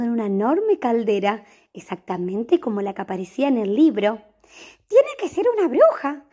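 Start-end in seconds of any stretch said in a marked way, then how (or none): 4.9-6.3 hopeful cat voice. Acted. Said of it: "pensó Horacio".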